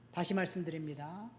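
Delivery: ordinary delivery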